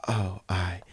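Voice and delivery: soft, low retold reaction; awkward hush. Young bagger unable to step in, awkward hush. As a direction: shrink back quietly, lost for words.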